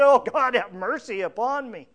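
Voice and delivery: loud cry for mercy, easing off. Acted. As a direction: cry out to God, acted. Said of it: "cry out to Him for mercy".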